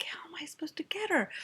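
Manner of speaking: high, hushed and hurried; retold helpless question. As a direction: half-whisper it fast, like a stumped mother. Helpless mother's reaction to plea.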